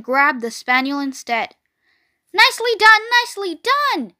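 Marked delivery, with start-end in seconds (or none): 2.3-4.1 high cheering cry; excited cry. A narrator's reading. Goose cheering Wilbur's dodge, excited cry. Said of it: "cried the goose".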